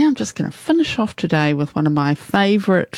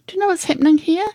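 high-pitched fussing at a mishap; exasperated; 6 semitones higher than usual. Real, not acted. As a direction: scold the glitch, voice pitched up. Host exasperated by wrong audio playing.

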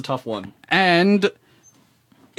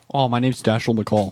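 ordinary talk, nothing marked

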